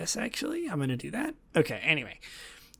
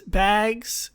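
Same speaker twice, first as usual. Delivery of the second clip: ordinary talk, nothing marked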